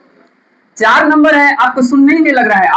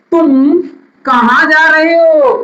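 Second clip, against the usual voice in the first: asking a question; 4 semitones higher, slower than usual. Shown as a demonstration. Teacher to students, acting out a sample question. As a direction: act out the question to a child. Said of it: "पूछा जाता है".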